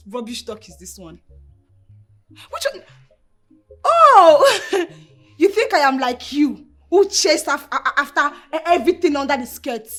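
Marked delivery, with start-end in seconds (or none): 3.8-4.6 loud, high drawn-out exclamation; outraged "oh". Acted. Wife stung by her husband's accusation, outraged exclamation. 5.4-9.9 high, loud, stammering comeback; angry retort. Acted. Wife firing back at her husband, angry retort.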